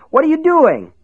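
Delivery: high, loud yell. A narrator's reading. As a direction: shout it out sharply. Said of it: "Ace yelled".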